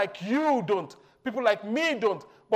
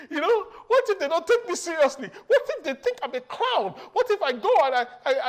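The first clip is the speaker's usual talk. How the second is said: higher, worried Moses voice; anxious Moses; 8 semitones higher, 5 dB louder than usual. Acted. Preacher voicing a worried Moses, higher and anxious. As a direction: fret like a nervous prophet.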